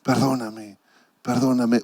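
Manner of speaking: soft, half-crying "perdóname" repeated; a hushed, broken plea for forgiveness; retold tearful plea. Pastor retelling his roadside prayer, in a soft tearful plea. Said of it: "comenzar a llorar y decirle".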